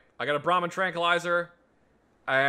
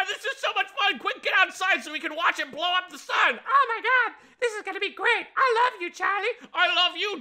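giddy excitement; 3 dB louder than usual. Pretend. Gleeful about blowing up sun.

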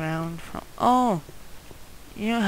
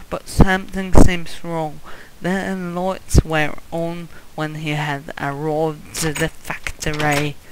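loud put-on English accent narration; hammed-up English accent, projected; 7 dB louder than usual. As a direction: read it aloud in a mock English accent. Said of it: "bring back my English accent".

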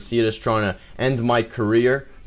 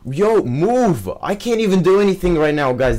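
higher, louder and fast complaint; frustrated yelling; 7 semitones higher, 3 dB louder than usual. Real, not acted. Gamer blocked by a teammate, yelling higher and faster. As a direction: vent at the teammate in the way.

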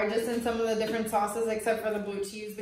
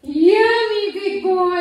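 much higher, louder sing-song praise; thrilled mom cooing at her son; 8 semitones higher, 10 dB louder, much slower than usual. Real, not acted. Mother praising baby eating.

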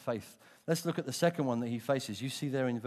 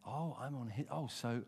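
ordinary delivery

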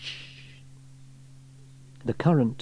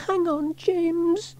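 calling out; much slower than usual. A narrator's reading. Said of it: "she called".

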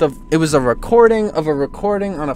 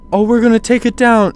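mocking Sony; 7 semitones higher, 6 dB louder than usual. Mocking Sony's promise.

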